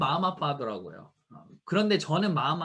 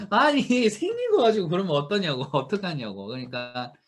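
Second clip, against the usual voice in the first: ordinary delivery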